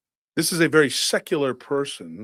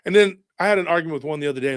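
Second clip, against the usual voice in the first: ordinary talk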